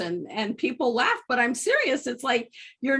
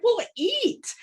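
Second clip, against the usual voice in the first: retold reply; 12 semitones higher than usual. Teller's own retold reply.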